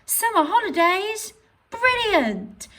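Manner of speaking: high, loud, thrilled; excited. Shown as a demonstration. Teacher demonstrating excitement for an exclamation mark. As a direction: gush about the summer holidays. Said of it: "You might use it for surprise or excitement".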